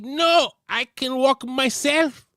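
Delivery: high, slow, drawn-out imitation wail; mock crying. Injured wrestler refusing help from crew members, mock crying. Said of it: "And she's crying".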